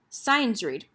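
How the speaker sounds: ordinary delivery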